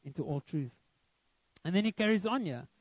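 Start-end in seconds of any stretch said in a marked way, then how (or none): 0.0-0.7 low, softened, lightly voiced divine promise; voice of God. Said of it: "But God says".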